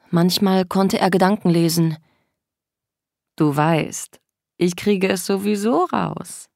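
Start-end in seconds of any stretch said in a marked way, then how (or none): 3.4-6.5 slow, wheedling tease; coaxing. A narrator's reading. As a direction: draw it out flatteringly, not to be trusted. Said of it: "etwas Schmeichelndes in seiner Stimme". (schmeichelnd, gedehnt)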